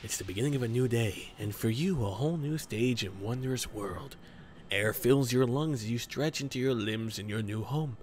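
slow, grand game intro read; trailer narration. Acted. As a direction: read it like an epic opening narrator. Reads the game's intro text.

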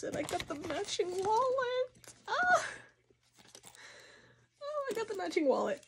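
high, soft, tearful with joy; happy crying. Real, not acted. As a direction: near tears over the matching wallet, real. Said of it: "I'm gonna cry".

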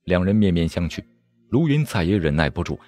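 plain reading voice

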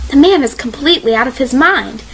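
ordinary delivery, nothing marked

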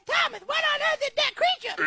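panicked shout; frightened cry. Acted. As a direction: scream at the creature from the boat. Wife panics in boat.

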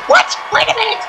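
high-pitched what, then a quick wait a minute; shocked squeal. Real, not acted. Commentator catching a surprise reversal, high shocked squeal. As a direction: squeak in pure disbelief.

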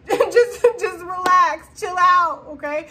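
laughing so hard she's almost crying; high, loud, laughing through the words. Real, not acted.

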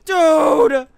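loud, pained wail of frustration; real despairing shout; anguished cry. Real, not acted. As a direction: cry out in defeat. Dying after hard fight.

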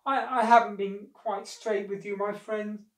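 high, faltering admission; distressed confession. A narrator's reading. Crocodile confessing to his monkey friend, distressed. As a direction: blurt it out with a stumble. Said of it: "he could take it no more".

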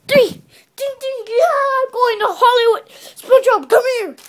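high loud victory cheer; excited shouting. Pretend. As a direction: celebrate the win loudly. Winner celebrating.

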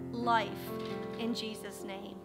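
ordinary talk

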